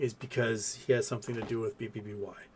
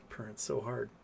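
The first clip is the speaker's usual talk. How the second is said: usual speaking voice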